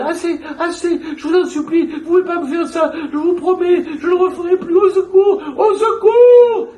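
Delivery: very high, loud pleading building to screams; desperate begging. Retold or imitated. Madwoman pleading with imagined attackers, rising into high screams. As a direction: beg for mercy, then scream. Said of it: "Et elle".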